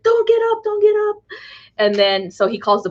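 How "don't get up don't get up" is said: high, urgent warning; mock urgent repeat; urgent dad. Dad protecting his child from gunshots, high urgent warning. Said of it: "he's like".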